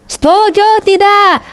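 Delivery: high, stretched call to a crowd; rallying cry. Real, not acted. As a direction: cry it out high to get an answer. Calling for the crowd's response. (seruan lantang bernada tinggi kepada jemaah)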